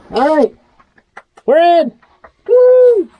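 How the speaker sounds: high, loud, cheering; excited. Real, not acted. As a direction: whoop like you made it through. Made it under the house.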